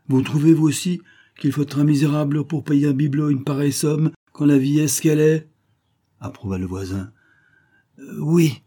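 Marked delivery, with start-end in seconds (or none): 0.1-5.5 high, louder, outraged question; indignant neighbour. Acted. Neighbour misreads the dealer's cry, asks indignantly. 8.0-8.6 hesitant, higher-pitched yes; stammering. A narrator's reading. Stricken dealer hides his shame from the neighbour, stammering. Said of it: "balbutia monsieur Gendre".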